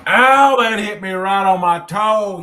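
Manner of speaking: loud real "Oh" of pain, then a sore complaint; pained yelp. Real, not acted. Fishing buddy drops something on his toe, loud pained outcry. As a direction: yelp as it lands on your toe.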